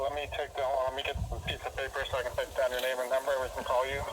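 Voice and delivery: ordinary talk, nothing marked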